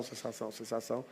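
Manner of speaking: sensação sumindo, bem baixinho; fading echo. Shown as a demonstration. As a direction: let each sensação fade away softly. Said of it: "sensação repeated, fading".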